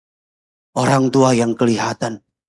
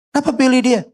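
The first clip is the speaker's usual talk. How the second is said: questioning boss; 9 semitones higher, faster than usual. Retold or imitated. Acted boss questioning the recommendation.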